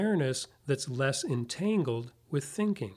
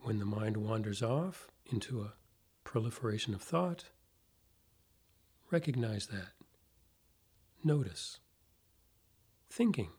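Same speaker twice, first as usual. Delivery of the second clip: guided meditation; 4 dB quieter than usual. Acted. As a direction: ease them into calm, softly, with long pauses.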